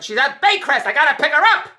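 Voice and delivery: high, loud mock yell; snapping it out in exasperation; retold shout. Andrea yelling back that Helen is at Baycrest, loud. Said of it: "And Andrea said".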